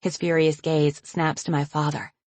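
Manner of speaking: ordinary delivery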